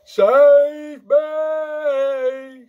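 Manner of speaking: long, high, loud cry for help; screamed. A narrator's reading. Giant trapped in a nightmare crying out, screaming. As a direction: scream it, holding each word. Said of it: "screamed the flesh lump eater".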